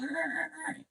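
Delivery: high, squeaky monkey cries; monkey screech. Retold or imitated. Retelling a movie, imitating the screeching monkey. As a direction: chatter like an angry monkey.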